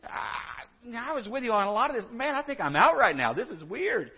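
high, quick, bemused bystander backing out; a hint of a baffled crowd member; puzzled listener. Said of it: "people at the time looked at that and said".

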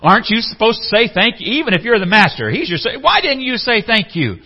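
high, loud indignant demand for thanks; indignant entitlement. Acted. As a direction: act hurt that no one said thanks. Servant expecting thanks, indignant.

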